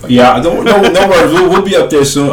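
ordinary talk

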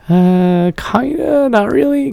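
high, sheepish hedging reply. Retold or imitated. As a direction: squeak it out awkwardly. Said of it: "I'm like".

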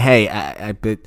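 ordinary talk, nothing marked

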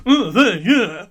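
high, loud sudden burst; loud excited booker. Retold or imitated. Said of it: "the guy booking it just goes".

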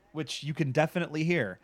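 usual speaking voice

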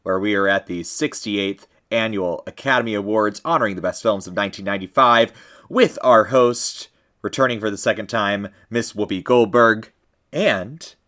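formal broadcast announcer read; awards announcer. Acted. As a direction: introduce it like a live TV host. Podcast intro as a live awards broadcaster.

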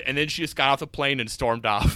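ordinary talk, nothing marked

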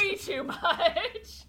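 loud, high, stretched-out words with a laugh; exaggerated echo. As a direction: draw it right out, laughing. Drawn-out echo of co-host's phrase.

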